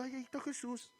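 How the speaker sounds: very soft, mock-timid remark; demonstrating a feeble, hushed call; meek murmur. Shown as a demonstration. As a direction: say it far too quietly to be heard. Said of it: "No estaba diciendo".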